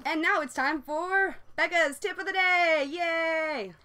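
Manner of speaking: bubbly TV-host intro then a cheer; announcer. Acted. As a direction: announce the segment with a big cheer. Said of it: "segment announcement".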